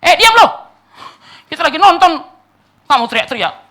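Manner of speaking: loud, high telling-off; imitated angry scolding. Spectators to the monk disturbing their show, imitated angry shout. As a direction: yell at a nuisance to shut up, easing off in pitch.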